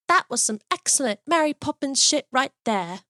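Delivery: put-on deadpan British child, said with perfect gravity; mild British accent, quoting a six-year-old. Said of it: "she's got a British accent".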